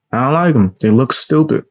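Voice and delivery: ordinary delivery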